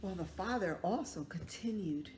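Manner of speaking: ordinary delivery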